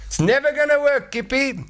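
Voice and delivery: very high squeaky mocking voice; shrill mocking skeptic caveman; doubting caveman. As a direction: needle Kippy in a squeaky sneer. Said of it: "somebody's saying".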